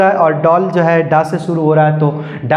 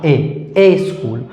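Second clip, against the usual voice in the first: slow model answer; 2 semitones lower, much slower than usual. Shown as a demonstration. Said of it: "इससे पहले क्या हो जाएगा".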